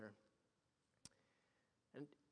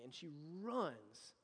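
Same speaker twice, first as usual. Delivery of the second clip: ordinary talk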